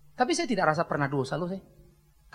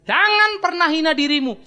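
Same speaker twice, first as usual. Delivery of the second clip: higher and louder, slow, "jangan" hit hard; louder repeat; 9 semitones higher, 8 dB louder than usual. Shown as a demonstration. Preacher repeats the warning, louder and higher. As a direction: drive "jangan" home with force. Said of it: "Sekali lagi".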